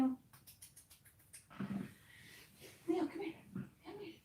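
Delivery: high, soft cooing at the kitties; high sweet pet talk. Real, not acted. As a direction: coax the cats in close with a coo. Cooing at the cats.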